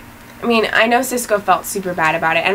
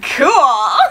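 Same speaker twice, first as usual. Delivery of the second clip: higher, laughing imitation of Iris; a giggly, high mock Iris; mock cool; 7 semitones higher, 4 dB louder than usual. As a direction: toss it off like an impressed girlfriend, laughing. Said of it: "She'd be like".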